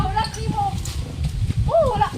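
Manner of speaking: ordinary delivery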